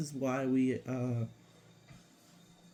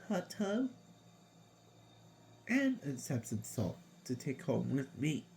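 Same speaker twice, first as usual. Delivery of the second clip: whistling nose; 4 dB quieter than usual. Real, not acted. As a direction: air whistling through the nose. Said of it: "that's, uh, my nose".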